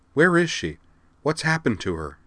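high, urgent first question, easing slightly; alarmed questioning. A narrator's reading. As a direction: demand news of a missing friend. Girl anxious about missing friend.

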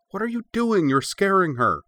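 high, loud indignant protest; crying out. A narrator's reading. Cora protests at guards scaring the puppy, crying out. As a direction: protest urgently, pitched far up. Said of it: "she cried".

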